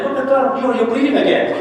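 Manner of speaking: high, hammed-up gasp of alarm; mock alarm. Preacher mocking the tempter, mock alarm. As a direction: fake shock at a bleeding cut.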